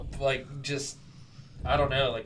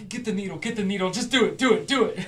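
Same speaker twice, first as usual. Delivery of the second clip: mock urging; 4 semitones higher, 3 dB louder than usual. Imagined urging of the piercer.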